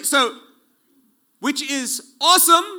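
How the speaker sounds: loud, high burst of awe; awesome. Real, not acted. Preacher to congregation, loud amazed awe. Preacher marvels at God's power.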